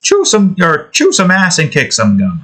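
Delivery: over-the-top Irish, pitched high; exaggerated Irish accent. Shown as a demonstration. Streamer fumbling a catchphrase in exaggerated Irish accent. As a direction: keep up the stagey Irish brogue. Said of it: "going the exaggerated".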